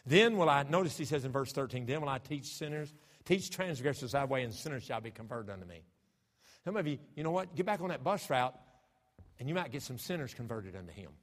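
loud fervent pulpit delivery; preaching. Real, not acted. Preacher exhorting his church to win sinners, fervent. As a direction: drive the verse home to the congregation.

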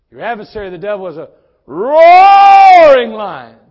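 preacher roaring the verse at the church; roaring lion. Shown as a demonstration. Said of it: "and I roar".

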